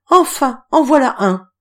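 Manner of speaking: high, loud glad cry; cry of relief. A narrator's reading. Girl embracing Octave cries out in delight. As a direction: she flings herself at him, crying out. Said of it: "s'écria".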